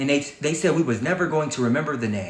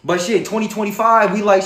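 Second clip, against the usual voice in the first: ordinary talk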